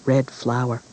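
ordinary delivery

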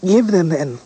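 put-on a proud girl daring the news; bolder retake. A narrator's reading.

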